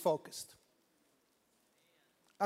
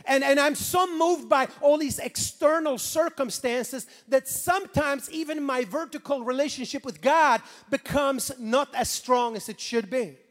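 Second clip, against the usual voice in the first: ordinary talk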